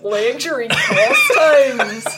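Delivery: slow laughing Irish accent attempt; drawn-out, giggly Irish lilt. Shown as a demonstration. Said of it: "Now that went a little Irish".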